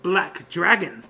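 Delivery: grand showman presenting his own invention; proud artificer. Acted. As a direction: present your armor with theatrical pride. Louis shows off his armor.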